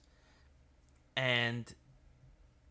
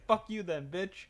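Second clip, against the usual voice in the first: mock parting insult; 9 semitones higher, 3 dB louder than usual. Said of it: "He's like".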